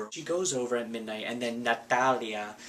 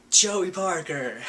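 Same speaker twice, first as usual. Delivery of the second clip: loud slow hype-man shout; mock cheering crowd; 7 semitones higher, 6 dB louder, slower than usual. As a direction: pump up his name, slow and loud.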